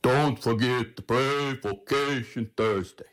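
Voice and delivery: slow, deep European priest impression; deep-voiced priest. Deacon retelling a priest's announcement at Mass, mock deep voice. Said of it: "He has this deep voice".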